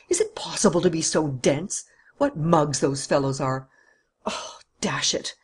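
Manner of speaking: whispered disgust; exasperated whisper. Acted. Don Luis whispers his disgust at the dense police. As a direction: mutter it under your breath.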